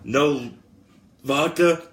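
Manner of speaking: punchy wrestler impression; Stone Cold Steve Austin. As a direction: call it out for the crowd's response. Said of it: "this is your boy, Stone Cold Steve Austin".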